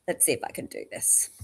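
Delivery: normal talking voice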